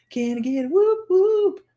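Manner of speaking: high, loud hype call. Real, not acted. Calling to the viewers for a hype response. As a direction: fire up the room.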